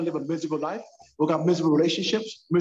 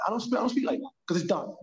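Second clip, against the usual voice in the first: ordinary talk, nothing marked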